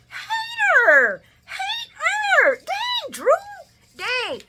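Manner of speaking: very high, loud shout; yelled heckle. Retold or imitated. Yelling at Drew for hating, high shout. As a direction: holler it out in high-pitched outrage.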